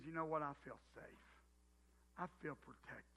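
soft, low, contented murmur; content wife. Retold or imitated. Husband voicing wife's contented sigh, soft and tender. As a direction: hush it like a wife feeling safe. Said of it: "that sigh of contentment".

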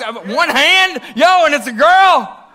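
high-pitched, loud astonishment; loud disbelief. Real, not acted. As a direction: call out in amazed disbelief at the lone hand. Hardly anyone responds to his question.

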